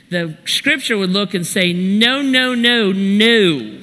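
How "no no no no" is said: insistent, corrective protest; emphatic objection. Acted. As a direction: cut in to overrule them, stretching each word. Scripture objects to harsh churchgoers.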